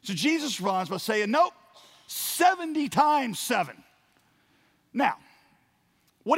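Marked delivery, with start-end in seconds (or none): 1.3-3.8 high, loud, drawn-out proclamation; emphatic correction. Acted. Jesus answering Peter's question, emphatic correction. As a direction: stretch it out big and loud.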